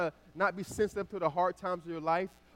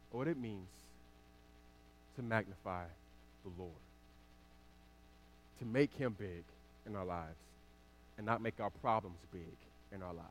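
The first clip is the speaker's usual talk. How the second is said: low, soft, with long pauses; hushed, earnest teaching; 7 semitones lower, 7 dB quieter than usual. Real, not acted. Preacher teaching what it means to magnify the Lord, hushed and measured. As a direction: let each phrase land quietly and low. Slow, earnest point of the sermon.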